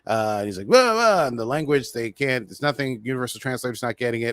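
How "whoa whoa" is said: high, loud startled whoa; alien warning. Retold or imitated. Humanoid stopping the crew, high loud warning. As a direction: shout to halt intruders.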